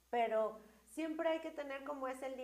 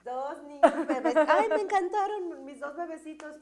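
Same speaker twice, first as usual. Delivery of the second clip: laughing delight; 5 semitones higher, 10 dB louder than usual. Real, not acted. Delighted about babies.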